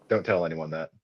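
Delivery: normal talking voice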